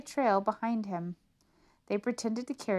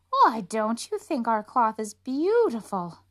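slow, louder, syrupy gush; gushing swindlers; 3 semitones higher, 8 dB louder, slower than usual. Acted. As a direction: lay the flattery on thick. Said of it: "gushed the swindlers".

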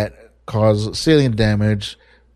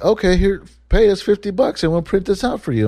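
much higher mock service voice; chirpy sales pitch; 9 semitones higher than usual. Legal website asking fifty bucks to print, high mock-helpful. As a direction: pitch it high like an eager upsell.

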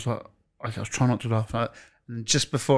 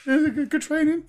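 much higher, squeaky nervous voice; mock nervous question. Reporter nervously asking about training in a high squeak. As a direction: squeak it out awkwardly.

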